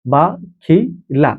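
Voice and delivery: বাখিলা stretched very slowly, each syllable held; drawn out slowly. Shown as a demonstration. Teacher drawing out বাখিলা slowly for students to repeat. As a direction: spell out বাখিলা slowly for the class to follow. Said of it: "teacher drilling the word".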